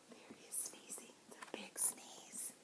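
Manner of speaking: soft hushed aside; whispering. Real, not acted. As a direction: whisper about the sneeze. Whispered aside about bear.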